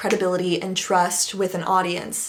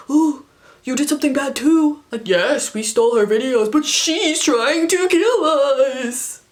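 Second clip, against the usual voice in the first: mocking the twins; 4 semitones higher, 5 dB louder than usual. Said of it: "I can't do an impression of them".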